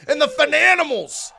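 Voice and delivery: high, loud build on the winners' name; excited announcing. Real, not acted. Host reveals new tag title winners, excited. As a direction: hype the title win like a ring announcer.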